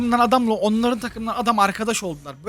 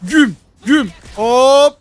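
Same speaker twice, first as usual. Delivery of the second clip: loud, punched one-word bursts; excited action calls; 4 semitones higher, 10 dB louder, much slower than usual. Real, not acted. Gamer calling hits during a fight, excited bursts. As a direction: punch out each move as it happens.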